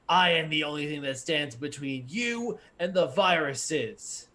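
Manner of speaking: loud, booming rebuke. Acted. GM voicing the CPU scolding the program, booming. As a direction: boom down at the fool from above. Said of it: "this booming voice".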